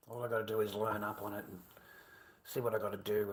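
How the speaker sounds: ordinary talk, nothing marked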